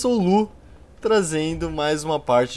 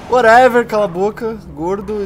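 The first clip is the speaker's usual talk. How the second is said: mock dismissive; 9 semitones higher, 9 dB louder than usual. Mock scolding himself.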